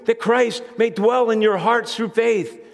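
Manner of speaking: ordinary talk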